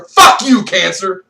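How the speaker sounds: real shouted curse; angry curse. Real, not acted. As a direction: hurl it at cancer. Cursing cancer.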